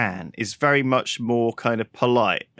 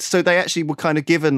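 ordinary delivery

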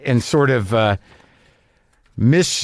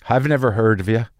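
dry Irish customs officer; deadpan it in an Irish accent; 3 semitones lower than usual. Retold or imitated. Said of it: "I can't do an Irish accent".

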